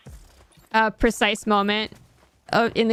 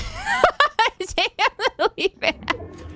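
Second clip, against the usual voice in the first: long, high-pitched cackling laugh; high laughter; 11 semitones higher than usual. Real, not acted. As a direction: crack up in high giggles. Cracking up at own accent.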